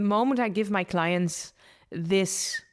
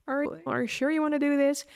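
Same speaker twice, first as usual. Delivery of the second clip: anxious inner voice, rising higher as the doubts pile up and speeding up at the end; nagging ego voice; 8 semitones higher than usual. Retold or imitated. Ego warning her against change, worried and pitched up. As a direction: voice the fretful ego second-guessing you. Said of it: "your ego is gonna tell you".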